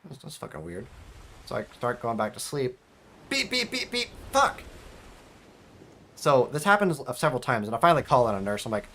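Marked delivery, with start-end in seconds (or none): 3.3-4.1 high mock alarm beeps; high-pitched mock machine beeping; imitated beeping. Imitating the EKG alarm again. 4.3-4.6 high, sharp fed-up curse; exasperated curse. Retold or imitated. Alarm wakes him again.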